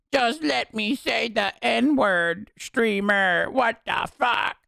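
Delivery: high, put-on pleading voice; whiny mock complainer. Chatter begging the streamer, whiny mocking voice. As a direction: plead like an exasperated whiner, high and drawn out.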